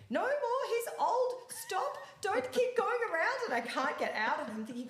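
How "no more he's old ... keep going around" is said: much higher, louder mock yelling, laughing through it; yelling coach. Andreas yells at the rider as the horse keeps spinning, high loud laughing yell. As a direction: shout her to stop, half laughing. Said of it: "Andreas is yelling at me".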